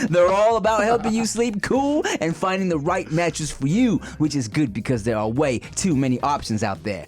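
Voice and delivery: upbeat host-read sponsor pitch. Acted. Podcast host reading a sponsor spot, upbeat ad read. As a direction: sell the mattress like a friendly plug.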